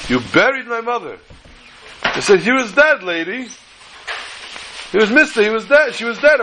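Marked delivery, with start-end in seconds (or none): 0.1-1.2 retold crying. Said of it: "The man started to cry". 2.4-6.4 higher, emphatic protest; defensive repeated insistence; impatient insisting. Retold or imitated.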